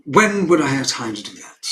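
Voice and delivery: loud, indignant rhetorical question; busy father's loud brush-off; dismissive indignation. Acted. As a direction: dismiss it out of hand, loudly.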